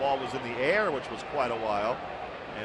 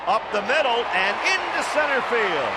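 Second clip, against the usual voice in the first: raised, louder live hit call; excited play-by-play; 7 semitones higher, 5 dB louder than usual. Real, not acted. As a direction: call the hit as it happens, high and loud.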